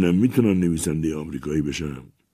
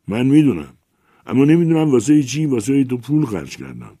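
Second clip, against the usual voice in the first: higher, louder, exasperated reproach; scolding; 5 semitones higher, 5 dB louder than usual. Acted. Patron scolds the poet he paid for, loud and exasperated. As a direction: lay into him for wasting your money.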